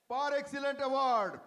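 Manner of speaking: loud ceremonial announcement; award proclamation. Real, not acted. Host giving Quality Circle Leaders their award, loud proclaiming. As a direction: proclaim it loudly after a suspenseful pause.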